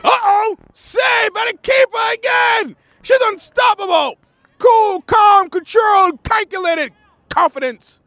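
high, shouted sustained call easing down word by word; excited play-by-play. Real, not acted. Commentator calling another keeper's save, excited shouting. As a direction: shout the big save, then land each word.

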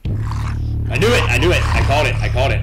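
high, loud I-told-you-so yell; loud excited outburst. Real, not acted. Streamer yelling triumphantly as the monster appears. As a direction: shout it like you predicted it.

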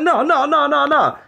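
high, loud rapid-fire noes; retold urgent protest. Man stopping neighbour from walking away, retold urgent protest. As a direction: cut him off, imitating an insistent man.

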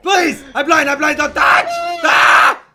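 frantic high shouting; panicked yelling. Real, not acted. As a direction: scream for help in real panic. Teammate in danger, he's blinded.

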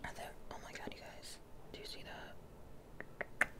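hushed excited whisper. Real, not acted. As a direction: whisper the reveal like a secret. Revealing baby result to viewers.

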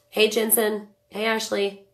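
usual speaking voice

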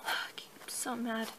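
slow, hushed and upset; slow whisper. Acted. Rider whispering slowly to her hurt horse. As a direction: breathe it out slowly, barely voiced.

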